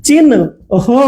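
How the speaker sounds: চিহ্ন broken up as chin, pause, ho; split pronunciation. Shown as a demonstration. Teacher demonstrating the sound order, চিহ্ন split into chin-ho. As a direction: say চিহ্ন in two parts, n before h. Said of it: "দেখেন উচ্চারণটা কিরকম হবে".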